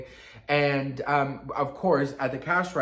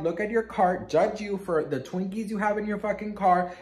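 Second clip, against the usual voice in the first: ordinary delivery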